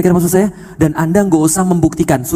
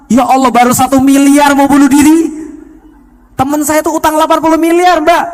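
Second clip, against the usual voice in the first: high, loud exclamation of disbelief; mock astonishment; 8 semitones higher, 5 dB louder than usual. Preacher retelling his reply to a woman in debt, mock astonished. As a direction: exclaim it, pitched way up, as if scandalised.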